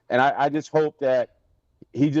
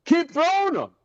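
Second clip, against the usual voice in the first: high-pitched mock coach call; retold coach's sarcastic encouragement, sung-out and high; 11 semitones higher, slower than usual. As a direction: imitate a coach calling out, pitch jumped way up. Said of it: "B A popped up and was like".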